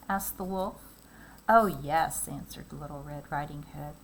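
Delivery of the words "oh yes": bright eager "oh yes". Acted. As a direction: answer eagerly, a little louder. Girl answers brightly.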